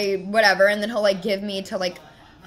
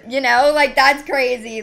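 louder, laughing in disbelief; 3 semitones higher, 9 dB louder than usual. Real, not acted. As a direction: laugh it off in exasperation. Exasperated laugh at the salon.

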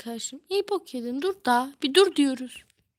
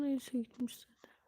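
whisper; 9 dB quieter than usual. Real, not acted. Aside to viewers.

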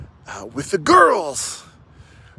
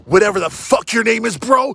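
usual speaking voice

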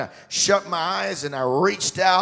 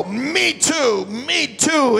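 higher, imitated praying along; retold eager echoing; 10 semitones higher, 7 dB louder, much slower than usual. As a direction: echo the prayer keenly, pitched up. Man echoing the prayer, retold.

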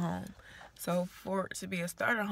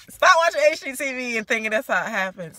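much louder, higher call-out; emphatic scolding; 5 semitones higher, 15 dB louder than usual. Real, not acted. First-time buyer calling out HGTV watchers, loud.

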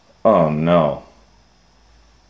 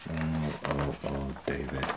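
soft dismay; 7 semitones lower, 17 dB quieter, faster than usual. Acted. Dismayed aside.

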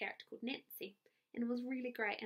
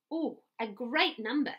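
astonished; 5 dB louder than usual. A narrator's reading. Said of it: "said the astonished Missus Phelps".

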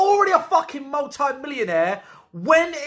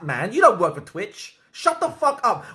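ordinary delivery

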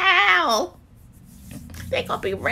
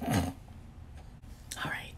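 whispering; 17 dB quieter than usual. Real, not acted. Hushed aside.

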